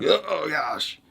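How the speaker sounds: high-pitched, hammed-up exasperation; exaggerated fed-up groan of words; mock frustration. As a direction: act out batting a thought away, pitched high. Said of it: "just don't be like".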